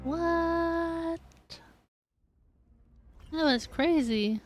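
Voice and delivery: ordinary delivery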